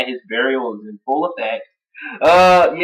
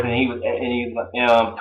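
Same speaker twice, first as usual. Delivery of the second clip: ordinary talk, nothing marked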